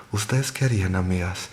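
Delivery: plain reading voice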